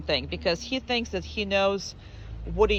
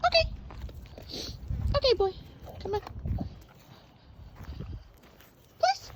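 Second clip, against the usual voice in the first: very high coaxing calls, some softer; coaxing the dog; 5 dB quieter than usual. Real, not acted. Trainer coaxing the dog to come, very high coaxing. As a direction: coax him along in a squeaky voice.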